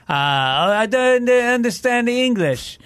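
high, slow mock Spanish accent for a Russian; botched Spanish accent. Russian engineer answering the complaining comedian, in a stray Spanish accent. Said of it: "I don't know why he had a Spanish accent".

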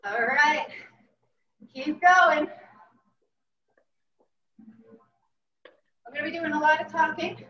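panting while jogging; out of breath. Real, not acted. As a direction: talk mid-workout, short of breath. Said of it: "If I sound out of breath, it's because I am".

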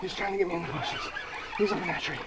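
breathless from the fight; panting between words; out of breath. Real, not acted. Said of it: "I'm out of breath".

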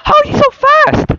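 high-pitched squeal. Real, not acted. Friend outrunning monsters.

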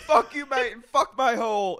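high, loud hammed-up bloke rant; mock James ranting. Podcaster mocking a reality star's rant, high loud yelling. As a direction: yell it like a cartoon lad losing it. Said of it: "James is like".

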